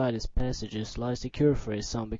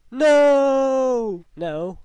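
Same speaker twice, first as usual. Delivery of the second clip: anguished no; 11 semitones higher, 8 dB louder than usual. Acted. Bacon gone.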